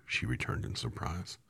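plain reading voice

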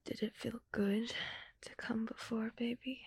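hushed, breathy seductive whisper; intimate whisper.

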